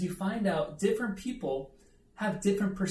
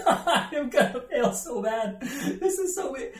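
higher, louder, slow, laughing through mock despair; giggly mock panic, pitched up; 9 semitones higher, 5 dB louder, slower than usual. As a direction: laugh at your own hopeless self. Panicked thought during test, laughing.

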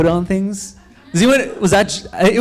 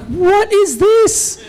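hammed-up disbelief, pitched way up; mock outrage; 11 semitones higher than usual. Rider told to do push-ups while pedaling, incredulous.

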